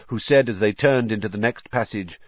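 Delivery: plain reading voice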